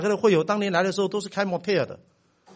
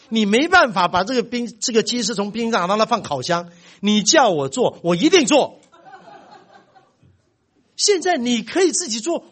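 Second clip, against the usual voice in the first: higher, earnest son explaining his logic; voiced teenage son; 6 semitones higher, 5 dB louder than usual. Son explaining to his father why he won't do it, higher earnest voice. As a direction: lecture your dad like a reasonable kid.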